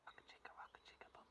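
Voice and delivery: quick breathy whisper echo; fast whisper. Shown as a demonstration. Storyteller echoes the chant to children in a fast whisper. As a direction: rush it back under your breath.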